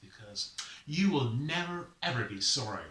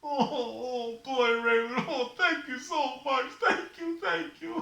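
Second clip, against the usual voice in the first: high, sobbing thanks; tearful gratitude; 12 semitones higher, 4 dB louder than usual. Acted. Sufferer thanks Raven for his help, high blubbering gratitude. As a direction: blubber thanks in a put-on cry. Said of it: "Don't cry".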